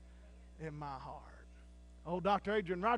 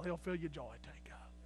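whisper; 4 semitones lower, 10 dB quieter than usual. Real, not acted. Preacher drops to a whisper.

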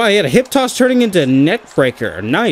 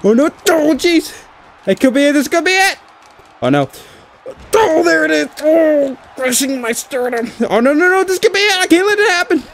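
desperate panic; 11 semitones higher than usual. Real, not acted. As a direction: panic as the pin closes in. About to be pinned, panic.

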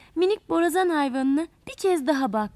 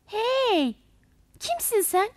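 startled water plant calling out; 6 semitones higher than usual. A narrator's reading. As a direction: call out from the leaf. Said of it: "diye seslenmiş".

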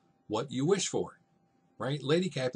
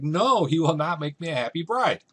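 loud, high-pitched protest as Juliet; defiant refusal; 6 semitones higher, 8 dB louder than usual. Acted. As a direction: refuse outright like an indignant daughter. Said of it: "Juliet's like".

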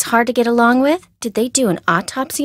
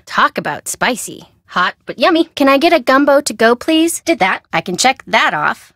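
usual speaking voice

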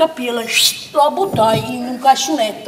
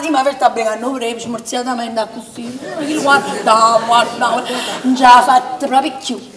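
ordinary talk

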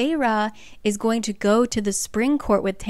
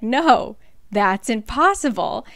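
much louder, with "No" pushed up and hard; louder insistence; 6 dB louder, slower than usual. Retold or imitated. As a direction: repeat the denial, now almost shouting. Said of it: "And Amren's like".

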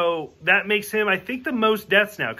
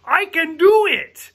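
eager Chekhov; 8 semitones higher, 6 dB louder than usual. Retold or imitated. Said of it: "And Chekhov's like".

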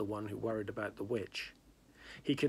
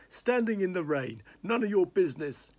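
hoarse, louder and higher; 7 semitones higher, 7 dB louder than usual. Acted. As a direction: rasp it out with a cold. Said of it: "he said hoarsely".

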